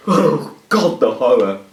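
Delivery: loud, hammed-up horrified outburst; mock horror. Showing viewers the faceless alternative, loud mock horror. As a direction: overplay the horror, loudly. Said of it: "Or do you want this?".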